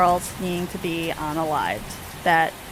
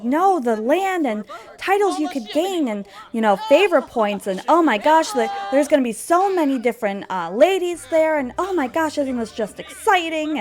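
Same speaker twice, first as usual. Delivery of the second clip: higher, animated mock countess; excited gushing; 6 semitones higher than usual. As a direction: gush eagerly, pushing the match. Countess gushing about ball and marriage prospects.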